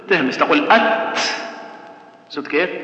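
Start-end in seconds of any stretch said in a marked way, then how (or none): 0.7-1.2 أت with a firm closed T, then a puff of breath; stop, then whispered release. Shown as a demonstration. Teacher showing how to press then aspirate, stop then whispered release. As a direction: clamp the T of أت, then let breath escape. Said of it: "تجيب الصفة القوية الأول الشد ثم".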